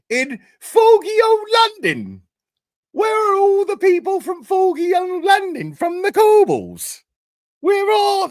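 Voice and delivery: high, slow, hammed-up posh English accent; mock Cockney-posh London voice. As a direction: lay on a cartoon London toff voice. Mocking English accent about London.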